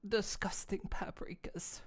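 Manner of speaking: slow, hushed disgusted whisper; slow pretend-wounded whisper. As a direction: breathe it out in mock disgust. Pelted with paprikas, whispering.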